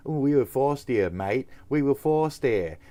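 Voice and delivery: put-on Australian protest; mock Australian. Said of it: "you fucking colonizers".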